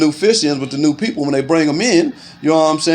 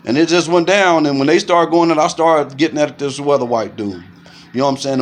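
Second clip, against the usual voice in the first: ordinary talk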